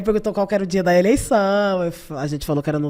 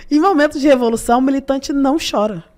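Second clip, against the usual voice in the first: higher, louder mock reprimand; Lula's scolding; 6 semitones higher, 5 dB louder than usual. President telling off a crying militant, mock scolding. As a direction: lecture sternly, imitating a leader. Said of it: "o homem vai me dar um esporro".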